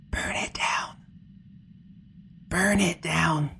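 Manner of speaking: hushed, eerie doll voice; whispering doll. Acted. Doll giving the player an order from the bag, in an eerie whisper. Doll speaks again, in game.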